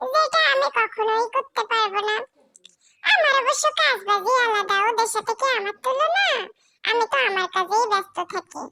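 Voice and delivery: ordinary delivery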